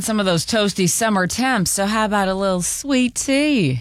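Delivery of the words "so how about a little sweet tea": bad put-on Southern accent. Newsreader tries a Southern accent on sweet tea, badly. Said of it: "don't try to have a southern accent".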